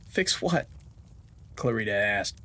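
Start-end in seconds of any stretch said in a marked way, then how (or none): 0.1-0.7 puzzled. Acted.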